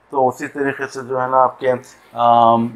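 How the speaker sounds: ordinary talk, nothing marked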